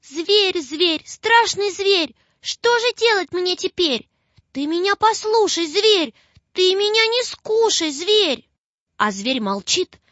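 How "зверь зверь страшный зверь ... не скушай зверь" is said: put-on high, pleading little girl, near tears; a child's frightened, weepy plea; tearful plea. A narrator's reading. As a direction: beg the beast through tears. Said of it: "Заплакала она и говорит".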